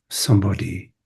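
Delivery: usual speaking voice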